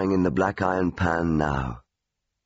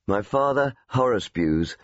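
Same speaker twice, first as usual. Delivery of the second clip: ordinary delivery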